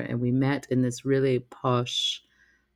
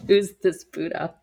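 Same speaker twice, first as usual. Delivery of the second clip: higher, louder, giggling disbelief; amused puzzled question; laughing confusion; 5 semitones higher, 4 dB louder than usual. Retold or imitated. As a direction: laugh it out like the name means nothing.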